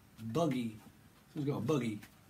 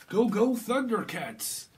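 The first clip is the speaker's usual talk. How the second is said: loud, high, drawn-out cartoon cry; hammed-up heroic shout; battle cry; 7 semitones higher, 5 dB louder, slower than usual. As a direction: bellow it like a cartoon rallying call.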